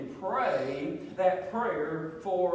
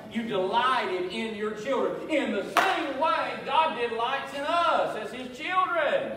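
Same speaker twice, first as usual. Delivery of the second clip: building to a raised, emphatic proclamation; forceful preaching; 3 semitones higher, 5 dB louder, slower than usual. Real, not acted. Preacher likening parents' joy to God's, forceful preaching. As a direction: swell into a preacher's full-voiced declaration.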